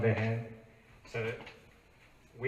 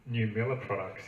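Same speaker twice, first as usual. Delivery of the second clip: usual speaking voice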